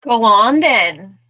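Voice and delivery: loud, slow goading call; emphatic storybook bird; daring bird. Acted. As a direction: dare the mouse with stretched words. Bird dares the mouse.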